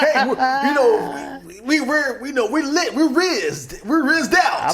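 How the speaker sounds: loud, high, hammed-up American slang; mock American accent. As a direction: play a Russian badly faking American. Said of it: "that's what the Americans do".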